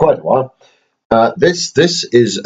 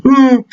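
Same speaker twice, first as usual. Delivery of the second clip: 1 teddy hum; 5 dB louder than usual. Pretend. Teddy Toronto reacts.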